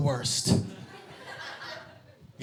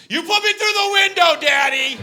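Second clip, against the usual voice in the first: put-on much higher, loud kid shout; daughter yelling; 9 dB louder than usual. Daughter in the rear seat, high loud accusing shout. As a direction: yell it from the back seat. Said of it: "she goes".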